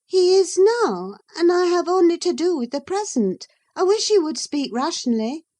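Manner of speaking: put-on a young lady answering back, pitched high; Catherine. Catherine's voice in the reading.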